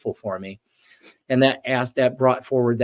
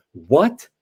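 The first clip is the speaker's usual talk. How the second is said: pissed-off retort; 3 semitones higher, 4 dB louder than usual. Retold or imitated. Man pissed off at the angel, indignant and louder. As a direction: snap back at the angel in disbelief. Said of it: "then I got really pissed".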